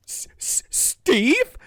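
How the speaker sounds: gasp, then stutter the name out; stunned stammer. Retold or imitated. Stacy answers Steve on the walkie-talkie, stunned stammer.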